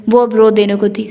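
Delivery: plain reading voice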